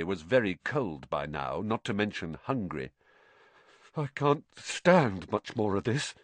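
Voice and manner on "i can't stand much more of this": ordinary delivery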